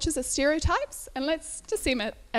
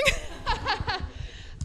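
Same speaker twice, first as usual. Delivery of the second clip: laugh; 11 semitones higher than usual. Real, not acted. Laughs at own joke.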